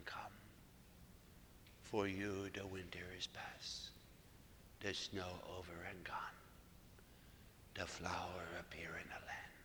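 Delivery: imitating her hushed, accented recital, breath between phrases; slight Mexican-American accent. Woman repeating Jesus's words to her priest, whispered Mexican-American accent. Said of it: "still has a slight accent".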